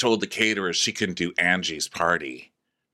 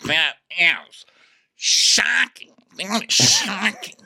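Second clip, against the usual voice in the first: much higher, louder, hammed-up shocked voice; mock Meredith; 11 semitones higher, 4 dB louder, much slower than usual. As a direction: play it up as Meredith, scandalized. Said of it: "Meredith's like".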